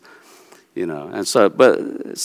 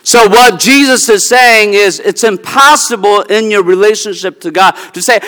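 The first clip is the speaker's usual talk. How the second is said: ordinary delivery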